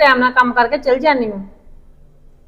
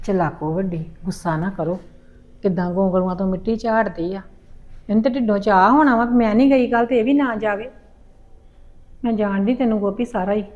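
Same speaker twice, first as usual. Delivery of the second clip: much lower and softer, grumbling under her breath; sulky grumbling; 6 semitones lower, 10 dB quieter than usual. Acted. Mother sulking at her daughter, softly grumbling under her breath. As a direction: mutter it to yourself, sulking.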